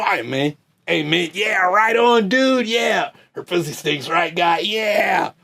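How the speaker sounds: mock fans nodding along, then a whoop; mocking dumb bro voice. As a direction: ham up the clueless fan agreeing. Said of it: "That's what y'all sound like".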